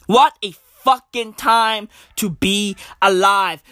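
normal talking voice

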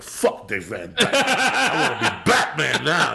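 slow and laughing through it; mock-defiant kid, laughing. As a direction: drag it out while cracking up. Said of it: "And I'm like".

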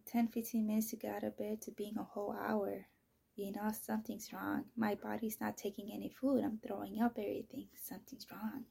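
ordinary delivery

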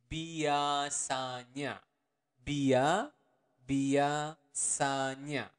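biasanya drawn out syllable by syllable; very slow. Shown as a demonstration. Teacher to learners, slow biasanya. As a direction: lay out biasanya slowly, bia- first. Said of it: "the normal speed would be biasanya".

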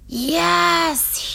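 high, drawn-out relieved yes; finally understood, exclaim it; long emphatic yes of relief. A narrator's reading. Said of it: "he said, relieved".

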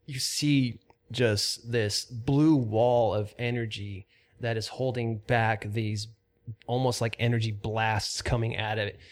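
steady, measured scene-setting; lightly dramatic dungeon description; game master narration. Acted. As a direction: paint the chamber for the players. GM sets the scene.

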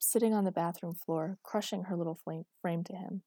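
ordinary delivery

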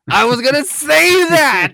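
high, loud outburst; outraged shout. Real, not acted. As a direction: yell it, joke stolen. Cohost steals his joke.

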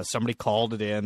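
usual speaking voice

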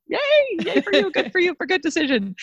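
high-pitched celebratory cheer; giddy little hooray for the listener; playful cheering. Pretend. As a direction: cheer them on in a high, excited voice.

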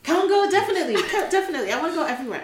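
high, loud and fast eagerness; bursting to travel everywhere; excited. Real, not acted. Telling the host where she longs to travel, excited. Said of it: "eager travel wish".

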